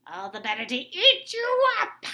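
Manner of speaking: high, loud wolf roar; loud high storybook roar. Acted. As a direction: roar it at the girl. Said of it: "roared the wolf".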